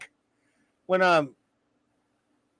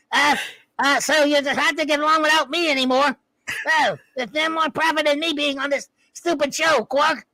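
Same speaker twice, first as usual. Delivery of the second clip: a higher, lightly voiced old Ferengi; a lightly voiced Ferengi elder, a bit higher; Zek impression; 6 semitones higher than usual. Zek apologising to Quark, in a lightly voiced higher tone. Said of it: "your Zek and your Gorn".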